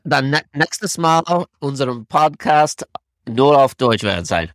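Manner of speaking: playful high-pitched broken German; fake foreign language. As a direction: ham up some pretend German. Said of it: "If you just pretend to speak German".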